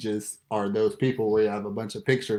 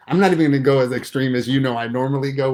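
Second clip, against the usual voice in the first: ordinary delivery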